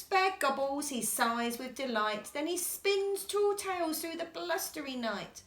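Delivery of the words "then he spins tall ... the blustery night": ordinary delivery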